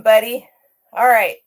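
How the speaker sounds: usual speaking voice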